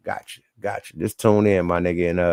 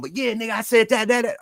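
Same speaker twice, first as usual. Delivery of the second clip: mock nagging; 12 semitones higher than usual. Mimicking someone who keeps arguing.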